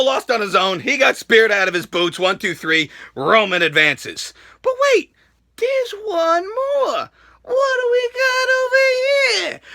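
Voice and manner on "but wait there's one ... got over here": high, drawn-out put-on voice; slow, squeaky mock announcement; hammed-up reveal. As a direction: stretch it out like a showman's tease. Teasing a surprise entrant.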